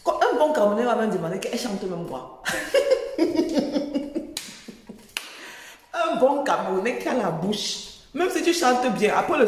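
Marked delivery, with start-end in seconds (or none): 2.5-4.4 laughing. Real, not acted. Laughing at own gossip joke.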